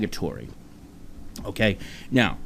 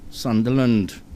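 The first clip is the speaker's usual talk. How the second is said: very dramatic British reporter; 5 semitones higher than usual. Acted. Host announcing the show's return as a BBC report, melodramatic British reporter. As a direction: stretch each phrase like momentous breaking news. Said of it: "Just very, very dramatic".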